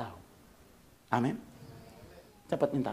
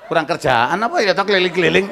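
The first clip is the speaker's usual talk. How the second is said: loud, teasing scoff; mock scorn; 6 semitones higher, 17 dB louder than usual. Real, not acted. Preacher scoffing at his own welcome banner, loud mock scorn. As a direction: poke fun at the banner. Mocking the world-travel banner.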